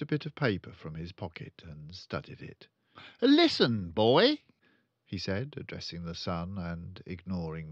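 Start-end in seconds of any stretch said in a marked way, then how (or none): 3.2-4.4 loud, high barked order; bossy father. Acted. Father ordering his son, loud and bossy. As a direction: snap it at the boy.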